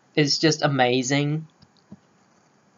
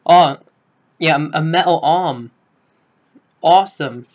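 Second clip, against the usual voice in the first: put-on light American accent as an excited teen; a British fan's try at an American accent; Spider-Man marvels at the metal arm in an American accent; 2 semitones higher, 4 dB louder than usual. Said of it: "that's just my American accent".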